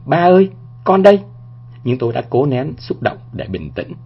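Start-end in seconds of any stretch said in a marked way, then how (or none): 0.1-1.2 high, loud call to a father; loud cry. A narrator's reading. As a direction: cry it out aloud, pitched up high. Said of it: "chỉ muốn kêu lên thật lớn". (kêu lên thật lớn, giọng cao)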